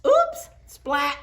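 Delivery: very high, loud yelp; surprised. Acted. Froggy smacking into Max on the sled, high startled yelp. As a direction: squeal at the crash. Crash on sleds.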